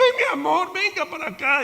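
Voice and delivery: put-on high, tender coaxing of a little girl; doting parent. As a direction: coo like a parent comforting a fallen daughter. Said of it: "la niña se caía un poquitico".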